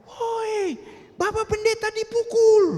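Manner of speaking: very high, loud call of alarm; old woman's shout. Retold or imitated. Old mama shouting to the neighbours that the pastor is beaten, loud high alarm. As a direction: yell it high across the street. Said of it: "dia berteriak".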